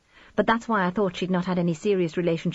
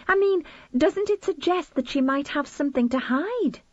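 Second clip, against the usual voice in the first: lightly voiced mother Edie; 9 semitones higher than usual. A narrator's reading.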